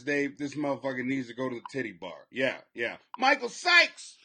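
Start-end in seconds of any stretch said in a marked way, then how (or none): 3.2-4.2 high, loud, stretched-out name call; shouted name. Real, not acted. Host suddenly calls out a viewer, shouted name. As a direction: holler the name out slowly.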